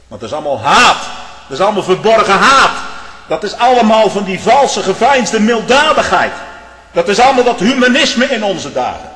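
loud, high fire-and-brimstone preaching; preaching at full force. Acted. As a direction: thunder it from the pulpit. Preacher denounces hypocrisy.

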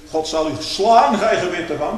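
hot-tempered rebuke. Acted. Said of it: "een driftig mannetje".

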